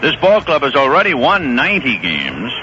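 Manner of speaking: usual speaking voice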